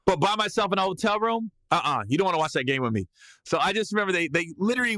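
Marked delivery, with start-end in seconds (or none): none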